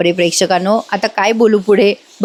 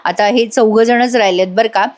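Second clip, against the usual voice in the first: ordinary delivery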